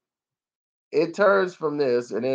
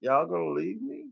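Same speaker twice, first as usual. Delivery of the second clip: small, soft, higher mock-pitiful voice; forlorn dinosaur; 5 semitones higher, 9 dB quieter than usual. Voicing the dinosaur left behind on the island, comic forlorn plea. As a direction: softly plead as an abandoned dinosaur. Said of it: "dinosaur's looking like".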